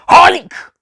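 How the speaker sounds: high, loud battle cry; shout. A narrator's reading. Doctor shouting while karate chopping the Master, loud battle cry. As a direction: bellow it mid karate chop. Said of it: "he shouted".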